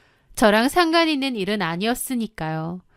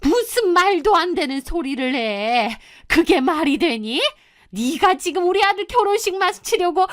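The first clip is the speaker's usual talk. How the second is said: high-pitched indignant mother; angry mother; 7 semitones higher than usual. A narrator's reading. Groom's mother rejecting the claim about her son's bride, angrily. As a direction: scold with rising pitch, outraged. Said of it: "곧 화를 내더라고요".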